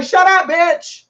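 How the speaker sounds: loud, high projected shout; big projected command. Shown as a demonstration. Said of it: "So you can project your voice".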